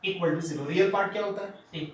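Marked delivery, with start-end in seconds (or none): 0.0-0.7 slow, spaced-out dictation pace; teacher dictating a definition slowly. Shown as a demonstration. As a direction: read it out word by word for notes.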